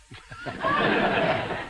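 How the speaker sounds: soaring airy laugh; real laugh. Real, not acted. Telling the interviewer about his lost lyrics, real laughter. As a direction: laugh it off, very high and breathless.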